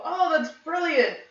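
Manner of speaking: high, excited cheer; overly cheerful praise to the grieving mom; enthusiastic. Acted. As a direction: throw up the hands in delight. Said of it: "raise my hands and go".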